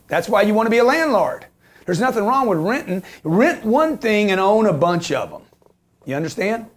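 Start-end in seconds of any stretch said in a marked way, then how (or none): none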